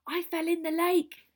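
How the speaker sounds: high, thrilled, exaggerated mouthing voice; small boy bursting with delight; excited mouthing. A narrator's reading. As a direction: mouth it proudly to a friend, high-pitched. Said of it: "gave a double thumbs up and mouthed".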